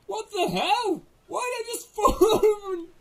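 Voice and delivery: high, loud startled outburst; shocked outcry. Real, not acted. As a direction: yelp in disbelief, half laughing. Character falls down in game.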